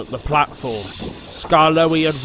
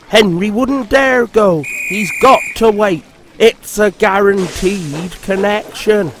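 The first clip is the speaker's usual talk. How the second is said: lady voice; 5 semitones higher, 5 dB louder than usual. Acted. Lady's voice.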